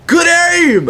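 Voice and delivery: high, chirpy "good aim" from the sub; mock sub voice. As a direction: toss it off like a cheerful substitute. Sub praises shot at Rob.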